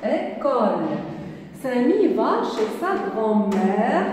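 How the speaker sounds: ordinary talk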